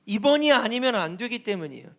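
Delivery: ordinary delivery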